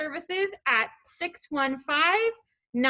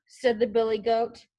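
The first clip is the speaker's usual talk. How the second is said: plain reading voice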